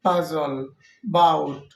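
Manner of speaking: don't stress the schwa in "পাজল" "বাউট"; teacher modelling the weak schwa in "পাজল", "বাউট"; clear example words. Shown as a demonstration. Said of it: "যেমন ফেমাস".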